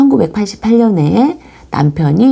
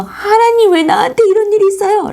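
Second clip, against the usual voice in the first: much higher, fast crying-out to God; anguished complaint; 14 semitones higher than usual. Retold or imitated. A sufferer complaining to God, high and fast anguished cry. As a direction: wail the question up at heaven. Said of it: "라고 원망을 해도".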